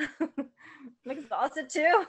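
slow words through laughter; laughing. Real, not acted. Laughing at shared tiredness.

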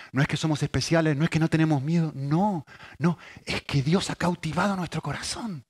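usual speaking voice

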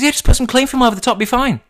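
high, fast airy voice of a clueless friend; imitating Helen. Mocking friend Helen's cling film plan, high and fast. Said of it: "She goes".

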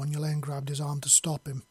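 normal talking voice

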